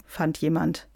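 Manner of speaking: ordinary delivery, nothing marked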